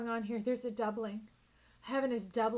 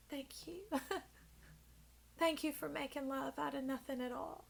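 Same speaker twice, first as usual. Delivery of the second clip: laughing through near tears, much softer and higher; close to tears; 3 semitones higher, 7 dB quieter than usual. Real, not acted. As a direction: voice catching as she thanks them, held back and soft. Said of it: "I'm about to cry right now".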